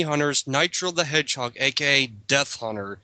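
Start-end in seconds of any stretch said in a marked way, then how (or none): none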